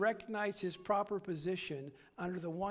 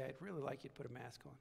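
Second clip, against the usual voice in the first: polite request; 5 semitones lower, 9 dB quieter, much faster than usual. Retold or imitated. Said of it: "they say".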